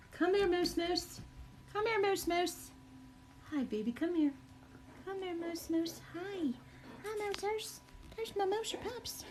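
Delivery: very high cooing dog talk. Real, not acted. Baby talk to her dogs.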